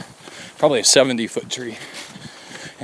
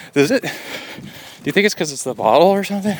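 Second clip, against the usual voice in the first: higher, quicker imitated kid; 6 semitones higher, faster than usual. Father imitating son questioning the odd-tasting water, higher and quick. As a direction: ask it fast and higher like a puzzled boy. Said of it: "And he's like".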